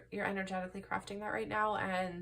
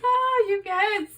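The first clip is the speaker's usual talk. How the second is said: overjoyed cry; 13 semitones higher, 13 dB louder, slower than usual. Real, not acted. Overwhelmed by the cards.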